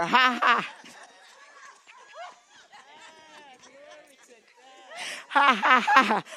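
loud, driving ha-has; preacher pumping out laughter; deliberate laughter. Shown as a demonstration. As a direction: laugh it out forcefully on purpose. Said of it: "Laugh, [name]".

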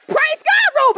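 high, loud mock command; calling out praise. Preacher directing Reuben in the acted healing, calling out. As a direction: sing out the order with force.

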